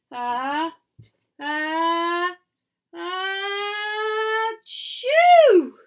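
rising gasps into an explosive achoo; building fake sneeze. Dust tickles nose, sneeze.

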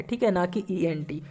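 ordinary talk, nothing marked

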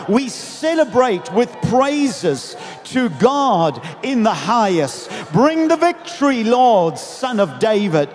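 slow, drawn-out praise rising to a high call; crowd's shout. Retold or imitated. Others in the crowd praise God, shouting. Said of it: "The others would shout".

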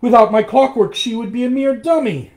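loud, very high outburst; high-pitched angry retort; quarrelling shout. A narrator's reading. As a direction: shout back in the heat of argument. Acted shouting quarrel over the doll.

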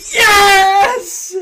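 very loud, very high celebrating yell; ecstatic scream. Real, not acted. Gamer finds Larry among the captains, ecstatic scream. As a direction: scream it in triumph.

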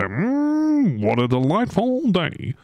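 high, savouring hum and lilt; acted delight. Streamer plays a fisher eating the catch, high mock delight. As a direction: savour it in a high, blissful put-on voice.

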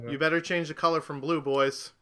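ordinary talk

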